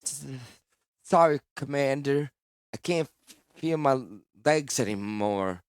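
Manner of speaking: shivering, halting dying voice; chattering teeth. Acted. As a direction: stammer through chattering teeth. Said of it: "spoke through chattering teeth".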